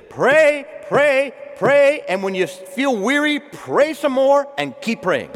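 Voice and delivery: loud, high urgent exhortation; fervent preaching. Acted. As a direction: preach it out at full force. Preaching at full force.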